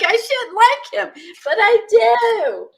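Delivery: high, swoony fan voice; mock guilty confession. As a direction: go high and stretch the ending, helplessly smitten. Voices guilty crush on bad man.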